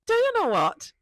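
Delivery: high, loud burst of inspiration; excited outburst. Retold or imitated. To herself, struck by the bowl idea, excited outburst. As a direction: blurt it out as the idea hits.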